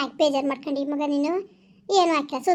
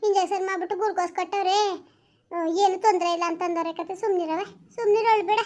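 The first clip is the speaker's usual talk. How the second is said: ordinary delivery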